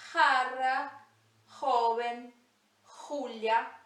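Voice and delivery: Jara, joven, Julia each starting with a throaty h; Spanish jota. Shown as a demonstration. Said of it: "sempre o som de dois R's".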